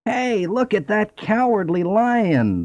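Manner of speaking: high, slow mocking donkey voice; a jeering donkey calling after the lion; braying donkey. A narrator's reading. Donkey jeers at the fleeing lion, high and slow. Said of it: "the donkey brayed".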